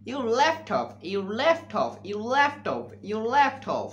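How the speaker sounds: laptop said slowly with a crisp t; correct pronunciation. Shown as a demonstration. Teacher giving students the correct laptop, slowly. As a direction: model laptop slowly and clearly. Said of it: "সঠিক উচ্চারণ".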